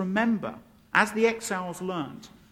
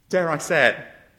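ordinary delivery

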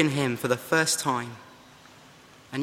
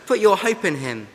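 urgent exhortation; 5 semitones higher, 6 dB louder than usual. Acted. Preacher exhorts his own soul.

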